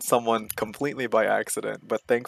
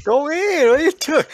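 very high, loud imitation yell; frantic mock scream; 10 dB louder than usual. Mimicking a teammate urging the dive, frantic mock scream. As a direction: screech it like a panicking teammate.

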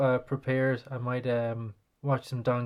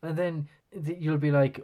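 ordinary delivery

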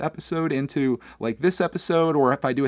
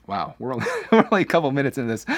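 laughing; faster than usual. Real, not acted. Laughing at own emotion.

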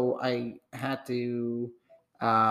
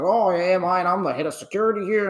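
puffed-up security guard, higher and louder; self-important head of security voice; pompous guard; 5 semitones higher, 5 dB louder than usual. Retold or imitated. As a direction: announce your title like a big shot. Guard announcing himself pompously.

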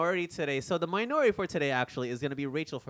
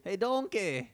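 higher mock Scottish call; playful Scottish ogre voice; Scottish Shrek; 5 semitones higher, slower than usual. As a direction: call out in a cartoon Scottish accent. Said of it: "Shrek is Scottish".

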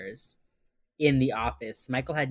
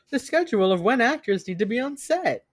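mock explainer; 8 semitones higher than usual. Mimicking detective's over-explaining line.

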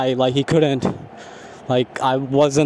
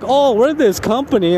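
mocking pompous company; 7 semitones higher than usual. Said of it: "act like they're all professional".

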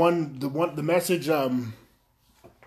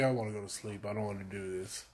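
much softer, lower, worn-out grumble; tired mumble; 5 semitones lower, 13 dB quieter, faster than usual. Retold or imitated. Driving to the recording, a sleepy much softer grumble. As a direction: mumble it like you're half asleep. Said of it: "I was like".

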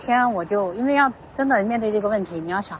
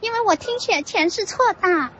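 much higher, proudly cheerful "全是错的"; happy showing off; 11 semitones higher than usual. Retold or imitated. Friend Yaoyao proudly showing off her wrong dictation, cheerful high voice. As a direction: brag cheerfully about getting it all wrong. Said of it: "很开心的展示说".

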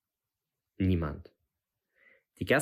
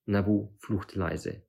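usual speaking voice

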